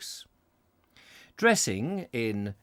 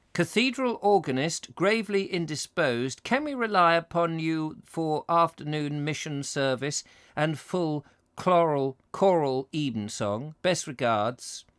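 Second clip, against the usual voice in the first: higher, declaimed telegram voice; formal telegram reading; 5 semitones higher than usual. A narrator's reading. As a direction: intone it like an official wire. Reads a telegram aloud.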